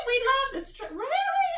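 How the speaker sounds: can't believe what she just read; incredulous disbelief. Real, not acted. Disbelief at headline claim.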